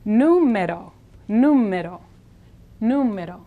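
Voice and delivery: "Número" as NOO-meh-do, r like the double d in buddy; tapped Spanish r. Shown as a demonstration. Teacher demonstrates the r like buddy, tapped. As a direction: stress NU in "Número", flick the r lightly. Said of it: "R is like the fast double D".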